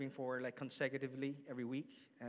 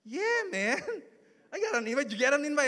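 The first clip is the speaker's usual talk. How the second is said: a much higher, louder, bubbly wife with a laugh; excited wife; 13 semitones higher, 9 dB louder, much faster than usual. Retold or imitated. Wife answering her husband's invite question, high and excited. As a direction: chirp it back eagerly, laughing. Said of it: "And my wife goes".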